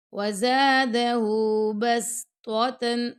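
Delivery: slow, measured tajweed chant; Quran recitation. Shown as a demonstration. Teacher reciting the verse to students, slow and melodic. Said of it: "زا کو لمبا کریں گے".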